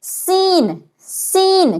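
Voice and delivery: سین with a slight whistle; whistling س. Shown as a demonstration. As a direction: hiss سین lightly like a whistle. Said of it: "ہلکی سی سیٹی کی آواز آنی چاہیے". (ہلکی سیٹی والی سین)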